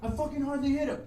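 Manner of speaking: high-pitched acted protest. Punched skinhead defends himself to Dave, high protest. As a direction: act out a whining defence.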